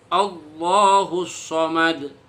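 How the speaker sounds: Allahus Somad drawn out slowly; slow recitation. Shown as a demonstration. Teacher giving the example answer, slowly recited. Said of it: "kasih contohnya dulu".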